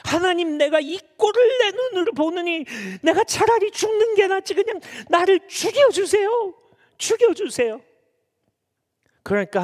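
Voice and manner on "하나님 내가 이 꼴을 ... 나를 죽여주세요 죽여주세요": high-pitched indignant protest; very angry Jonah. Acted. Jonah demands that God kill him, acted high furious protest. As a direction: wail it in fed-up fury, pitched way up. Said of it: "요나가 얼마나 화가 났으면".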